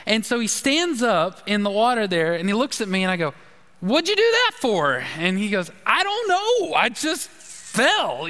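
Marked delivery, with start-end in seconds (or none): 3.8-5.0 high, incredulous retold question; pitched-up mock disbelief; mock exasperation. 5.8-8.3 high, slow, whiny little voice of the guilty friend; pitched-up innocent shrug; sheepish friend. Retold or imitated.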